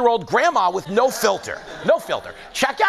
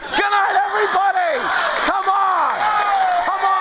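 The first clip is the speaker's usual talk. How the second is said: mock sign-off shout; 12 semitones higher than usual. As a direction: yell it like ending the show.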